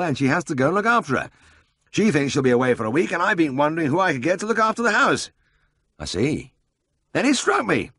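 high, lively voice for the bustling boss; brisk Siegfried. A narrator's reading. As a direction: play the eager older brother full of a plan.

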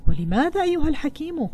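storybook astonishment; astonished. A narrator's reading. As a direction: ask in narrated amazement, pitch leaping up. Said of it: "فسأله التاجر بدهشة".

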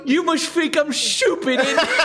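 put-on British accent. Meme British voice.

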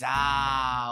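ordinary talk, nothing marked

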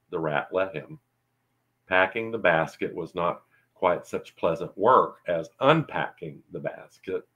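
ordinary delivery, nothing marked

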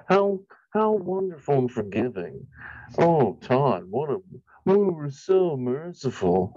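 drawn-out sarcastic praise. Pretend. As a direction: gush with fake gratitude.

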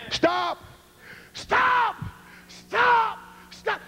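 high yelled stop; yelling. Shown as a demonstration. Preacher showing how he warns people, yelling. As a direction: shout each stop as a warning. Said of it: "why is he yelling and screaming".

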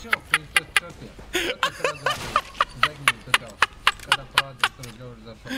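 loud, high, furious taunting; angry yelling. Real, not acted. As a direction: yell it at him in anger.

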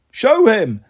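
pleading. Acted. Snake Martha begs Hermes to show Percy, pleading. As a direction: beg in a high character voice. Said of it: "Martha pleaded".

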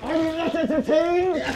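ordinary delivery

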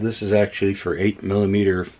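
ordinary talk, nothing marked

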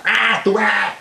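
sharp high yell like a scolding mother; mock mother's yell. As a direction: bark it out like a mum calling her kid. Mother yelling at her child. (grito de madre regañando)

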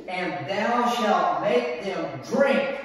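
drawn out, rising on drink; slow scripture reading. Acted. Preacher reading the verse to the church, slow. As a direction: linger on each word.

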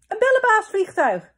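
put-on very high, loud girlish delight; happy exclamation. A narrator's reading. Twin sister joining in the cheer, delighted exclamation. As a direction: burst out with it, thrilled. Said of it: "riep Pip blij".

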